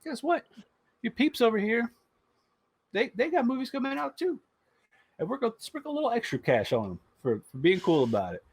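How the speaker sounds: cartoon mouse voice, high and teasing. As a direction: play the smug mouse gloating over rivals. Disney voiced as Mickey Mouse.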